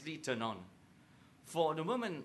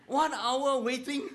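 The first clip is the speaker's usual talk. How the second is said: much higher, louder, slowed disbelieving cry with a laugh; mock shock; 11 semitones higher, 7 dB louder, slower than usual. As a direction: stretch it out in hammed-up horror. Men react to massage advice.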